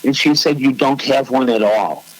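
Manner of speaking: ordinary delivery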